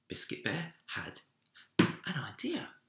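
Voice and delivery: hushed storyteller whisper; whispered narration. A narrator's reading. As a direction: whisper the idea like a secret. Whispered discovery of idea.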